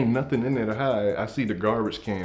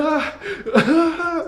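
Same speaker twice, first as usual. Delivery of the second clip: high, loud startled shrieks; mock scream; 5 dB louder than usual. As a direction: imitate a teacher screaming in shock. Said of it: "so she screams".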